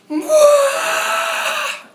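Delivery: a sudden high, loud wailing cry. Retold or imitated. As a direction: burst into a shouted sob. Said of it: "and I just went".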